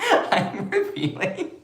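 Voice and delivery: high, slow words through laughter; laughing. Real, not acted. Streamer starting an announcement to viewers, laughing. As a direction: crack up while trying to speak. Cracking up while restarting a sentence.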